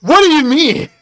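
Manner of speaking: high-pitched indignant demand; outraged disbelief. Real, not acted. Challenging chatter over British jibe, outraged. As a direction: squeal the question in disbelief.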